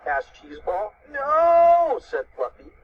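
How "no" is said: high, loud, drawn-out no; emphatic denial. A narrator's reading. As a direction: stretch the no out high. Character's reply in voiced story.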